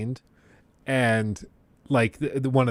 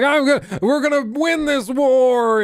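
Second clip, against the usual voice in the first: mock scream; 12 semitones higher, 5 dB louder than usual. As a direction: imitate an overcommitted actor rallying his troops. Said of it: "screaming at dinner".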